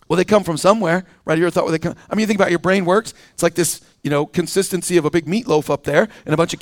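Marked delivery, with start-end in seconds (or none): none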